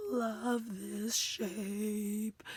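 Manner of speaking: very slow stretch on "love this shape"; drawn-out adoring. Real, not acted. Crafter loving this shape, slow and drawn out. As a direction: linger on each word, voice fading to breath.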